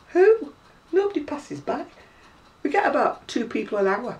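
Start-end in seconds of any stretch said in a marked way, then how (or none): 0.1-1.9 mock disbelief. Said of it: "I went".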